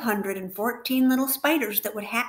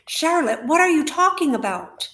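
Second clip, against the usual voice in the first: higher and louder, alarmed pleading; the pig begs to know what she means; 7 semitones higher, 7 dB louder than usual. Acted. Wilbur alarmed by Charlotte's news.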